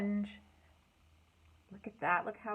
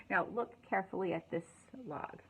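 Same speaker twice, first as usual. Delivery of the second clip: ordinary delivery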